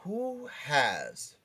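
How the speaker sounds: ordinary talk, nothing marked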